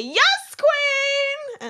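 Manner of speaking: high, loud, drawn-out cheer; celebratory yell. Retold or imitated. Imitating the show's hosts cheering, high yell. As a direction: whoop it out long and high. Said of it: "when they yell".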